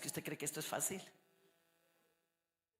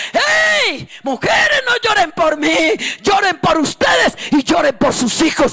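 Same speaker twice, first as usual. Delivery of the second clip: sobbing, shouted cry, much higher; 25 dB louder, slower than usual. Acted. Jesus on the cross calling to the weeping women, sobbing shout. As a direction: cry out Jesus's words through tears.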